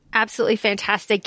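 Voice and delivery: ordinary talk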